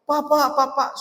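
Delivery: put-on high, urgent child calling out to father; child calling papa. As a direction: call out like a small child running in. (anak kecil memanggil ayahnya dengan suara tinggi)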